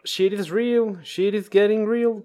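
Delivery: high, slow mock-ominous English; mock dramatic English line. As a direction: ham up the doom, lightly. Mock dramatic joke about coronavirus. (tono dramático de broma en inglés)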